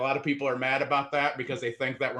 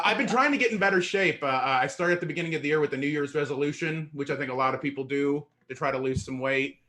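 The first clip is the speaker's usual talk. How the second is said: ordinary talk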